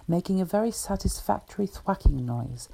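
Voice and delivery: plain reading voice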